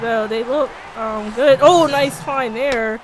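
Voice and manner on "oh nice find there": usual speaking voice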